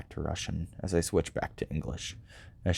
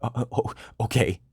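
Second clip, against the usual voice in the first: shaken; 5 semitones higher than usual. Acted. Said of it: "shaken by Krieg's outburst".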